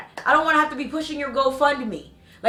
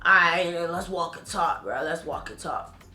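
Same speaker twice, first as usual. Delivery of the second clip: low, hushed man's voice; imitating his reply; 6 semitones lower, 5 dB quieter than usual. JJ inviting her aside to talk, low and soft.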